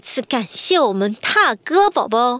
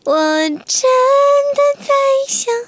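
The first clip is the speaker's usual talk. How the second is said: put-on high, slow, squeezed girly voice; cutesy falsetto voice; 14 semitones higher, 4 dB louder, much slower than usual. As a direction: sing it in a sweet girly pitch. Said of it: "用夹子音唱".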